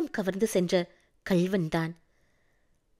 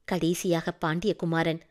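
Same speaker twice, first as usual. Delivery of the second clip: usual speaking voice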